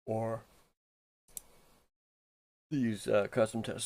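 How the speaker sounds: words stretched by a yawn. Real, not acted. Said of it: "Sorry, I'm yawning here".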